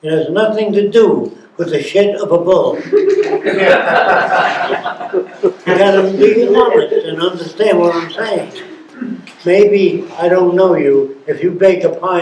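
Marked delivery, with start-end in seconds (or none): 2.8-5.1 a breathy, unvoiced burst of laughter; airy laugh. Real, not acted. Speaker laughs at his bull joke, airy laughter. 5.1-8.5 words still carried on laughter; laughing. Real, not acted. Urging the audience to understand, still laughing. Laughing after his own joke.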